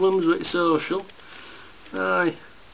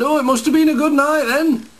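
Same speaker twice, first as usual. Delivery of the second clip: higher-pitched, lightly teasing; jokey quip; 8 semitones higher, 4 dB louder than usual. Acted. Tradesman cracks a gag to put a rough-looking single mum at ease, jokey. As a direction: crack a gag in a lifted, chirpy lilt. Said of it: "crack a gag, put her at her ease".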